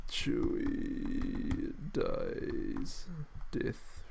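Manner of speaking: very slow, unvoiced murmur; absent-minded muttering. Real, not acted. Streamer looking up a film scene, slow quiet self-talk. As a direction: mumble the words piece by piece, softly. Searching for the clip to show.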